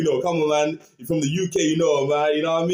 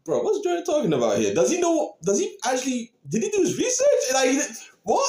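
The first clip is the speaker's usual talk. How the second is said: higher and higher, incredulous questions ending in a laughing yelp; baffled disbelief; 8 semitones higher than usual. Retold or imitated. Retelling how he and his colleague reacted to [name]'s bars, incredulous. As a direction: retell your own stunned confusion, climbing in pitch. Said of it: "we were both like".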